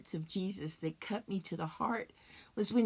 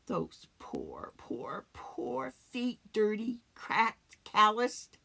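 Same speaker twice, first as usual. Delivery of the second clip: pitying whisper; 3 semitones higher, slower than usual. Acted. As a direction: whisper slowly in pity. Pitying Jesus's worn feet.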